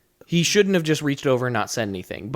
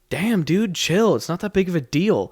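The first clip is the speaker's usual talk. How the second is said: higher, offhand brush-off; mock dismissive taunt; 5 semitones higher than usual. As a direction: toss it over your shoulder at him. Coworker taunting customer leaving.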